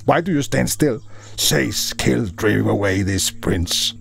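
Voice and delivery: high-pitched urgent order to an army; rally the troops in a touch of panic; panicked command. A narrator's reading. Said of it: "somewhat now in panic, commanded his army".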